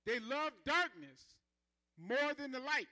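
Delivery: slow, loud repeated line; preaching refrain. Acted. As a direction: drive the line home slowly. Preaches at full force.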